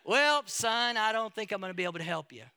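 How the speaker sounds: lightly put-on American South accent for the old panel beater; Southern US accent. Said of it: "so that's what it sounds like".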